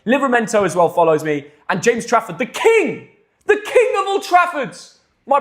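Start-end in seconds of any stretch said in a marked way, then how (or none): 2.4-5.4 high, drawn-out hype; hyped acclaim. Pretend. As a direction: proclaim him grandly, pitched way up. Hails his favourite player.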